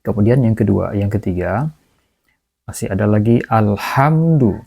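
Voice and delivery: ordinary delivery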